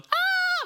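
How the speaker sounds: very high, loud terrified scream; scared sailors screaming high; shrill scream. Acted. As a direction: shriek in total fright. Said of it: "Men da skrek vi sånn".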